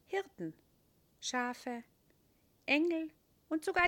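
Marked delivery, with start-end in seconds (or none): none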